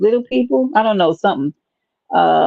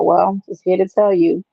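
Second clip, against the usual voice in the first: ordinary delivery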